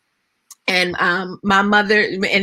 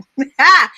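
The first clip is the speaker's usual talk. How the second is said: amused laugh; 4 semitones higher, 7 dB louder than usual. Real, not acted. Laughs at the cussing memory.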